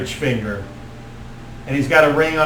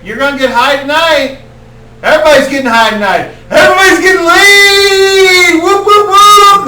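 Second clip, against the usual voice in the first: wild party hype; 11 dB louder than usual. Acted. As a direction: pump up the whole crowd. Stoner druid hyping the crowd.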